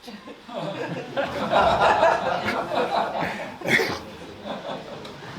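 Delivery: long breathy laugh at himself; real laugh. Real, not acted. Monk laughing at himself before the listeners, breathy laugh. Laughs at his own inner faults.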